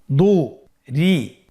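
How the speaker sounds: "দু, রি" slowly as two separate sounds; syllables apart. Shown as a demonstration. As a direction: split "দু, রি" with a clear pause. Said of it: "এভাবেও পড়তে পারেন". ("দু, রি" ভেঙে ভেঙে ধীরে)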